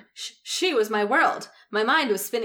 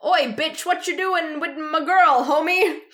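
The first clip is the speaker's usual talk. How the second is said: higher, louder mock tough guy; gruff thug voice; 7 semitones higher, 5 dB louder, slower than usual. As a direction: bark it like a street thug. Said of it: "a heavy, gruff voice".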